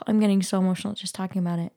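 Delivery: ordinary talk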